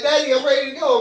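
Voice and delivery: a hint of an eager kid; daughter. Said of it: "She's like".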